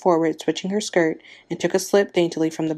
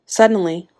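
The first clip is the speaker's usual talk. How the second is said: plain reading voice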